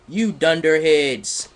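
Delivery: loud slow high yell; screamed. Acted. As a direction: yell it out slowly. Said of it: "screamed Dumbledore".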